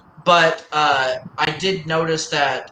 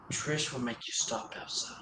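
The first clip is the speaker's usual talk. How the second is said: whispering; 4 semitones lower, 16 dB quieter than usual. Real, not acted. Teasing aside to Elsa.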